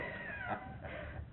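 high, breathy burst of laughter. Retold or imitated. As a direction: laugh helplessly. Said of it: "She's killing herself laughing".

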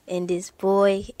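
usual speaking voice

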